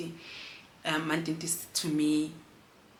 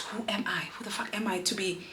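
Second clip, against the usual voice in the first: usual speaking voice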